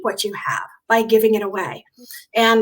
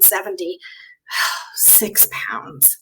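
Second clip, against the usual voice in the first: whispered admission; 5 semitones lower, 9 dB quieter, much slower than usual. Real, not acted. Host confesses her highest weight, whispering the last word. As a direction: drop to a breathless whisper on pounds.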